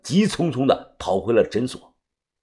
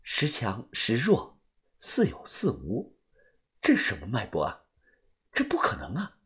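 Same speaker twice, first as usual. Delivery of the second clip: very soft, disbelieving murmur of a doctor; hushed shock; 4 semitones lower, 11 dB quieter than usual. A narrator's reading. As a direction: puzzle over it under your breath, astonished. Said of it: "宋医生眼露震惊地说道".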